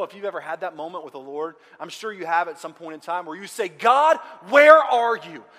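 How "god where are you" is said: loud, drawn-out, higher-pitched plea; anguished cry to God. Acted. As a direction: cry out slowly and loudly to God.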